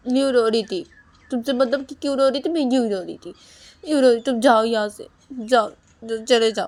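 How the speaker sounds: high, loud go-away shouting; angry dismissal. Acted. Wife shouting at husband to leave, angry. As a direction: drive him out of the room.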